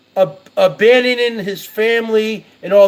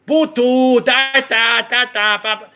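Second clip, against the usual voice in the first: mocking fake Chinese gibberish; slower than usual. Retelling Hosey greeting Chinatown locals, in mock gibberish. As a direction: parody a man babbling fake Chinese at passers-by. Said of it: "Hosey would say, like".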